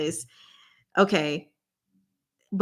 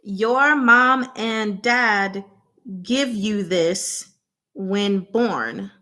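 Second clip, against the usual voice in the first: slow clue; much slower than usual. Real, not acted. Slowly spelling out a clue.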